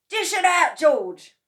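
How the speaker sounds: high, loud eager call; cried out. A narrator's reading. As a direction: urge the boy on at full voice. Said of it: "cried Mister Cranky".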